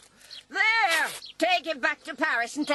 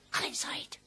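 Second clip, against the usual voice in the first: whisper; 10 dB quieter than usual. Acted. Mother fears watchers.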